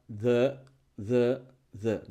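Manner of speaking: "the" as a low, weak thuh; weak schwa. Shown as a demonstration. Teacher models the weak article, schwa thuh. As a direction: let "the" fall on a neutral uh. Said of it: "Es débil".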